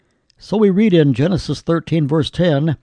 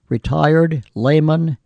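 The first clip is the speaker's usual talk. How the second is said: ordinary delivery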